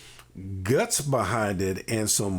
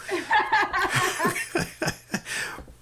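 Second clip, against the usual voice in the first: a long, much higher delighted laugh; high burst of laughter. Real, not acted. Delighted by his cake joke.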